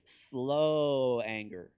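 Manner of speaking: "slow" stretched long and loud. Shown as a demonstration. Preacher contrasting slow anger with blow-ups, drawn out. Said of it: "to slow it down".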